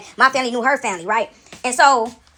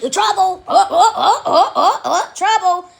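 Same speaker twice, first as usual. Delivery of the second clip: higher, louder rhythmic taunt; mock alarm; 5 semitones higher, 3 dB louder, slower than usual. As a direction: pulse it out like a warning siren. Mimicking churchgoers' reaction to criticism.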